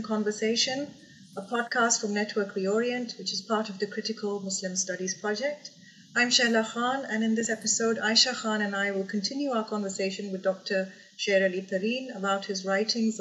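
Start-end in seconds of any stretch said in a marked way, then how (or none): none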